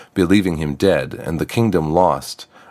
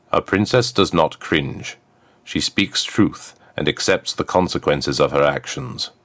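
stern command. Acted. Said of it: "The crown's voice was a command she must obey".

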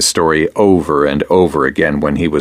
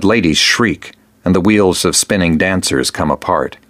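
plain reading voice

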